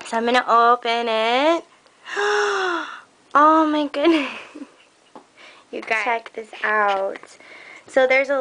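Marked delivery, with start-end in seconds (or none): 2.1-2.9 high, drawn-out gasp; amazed gasp. Real, not acted. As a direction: gasp at the big reveal. Opens the box.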